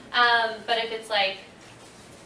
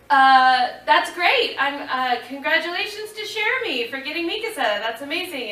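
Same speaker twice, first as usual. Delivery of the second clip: forced polite congratulations; 7 semitones higher, 8 dB louder than usual. Retold or imitated. Thinks someone else got role.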